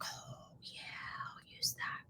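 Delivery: breathless whispered reply; sheepish whisper. Retold or imitated. Answering husband's suggestion, sheepish whisper. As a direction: whisper it like you're caught out. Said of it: "And I'm like".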